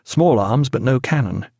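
usual speaking voice

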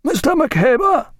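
high, long-drawn captain's hail; calling down table. A narrator's reading. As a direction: hail your officer at the table's far end. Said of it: "called Captain Fellows down the table".

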